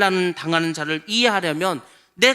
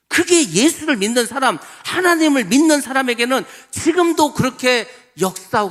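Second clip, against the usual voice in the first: louder, higher impassioned preaching; preaching at full force; 6 semitones higher, 5 dB louder than usual. Real, not acted. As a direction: proclaim it to the whole congregation. (힘차게 외치는 설교)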